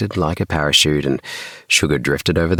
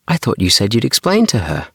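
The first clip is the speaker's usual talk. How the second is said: accusing; 7 semitones higher, 3 dB louder, faster than usual. Acted. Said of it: "I looked at Dad accusingly".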